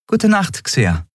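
high voice falling to normal pitch; Endriel. A narrator's reading. Endriel returns Xea's goodnight, high voice dropping at the end. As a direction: start high and let the name drop.

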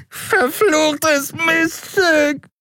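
shrill, very high, drawn-out yelp; yowling curse. A narrator's reading. Broken-nosed addict yowls at the woman who hit him, shrill drawn-out yelp. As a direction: howl the insult through a broken nose.

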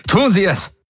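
sudden high outburst; blurted. Acted. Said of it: "platzte es aus Galen heraus".

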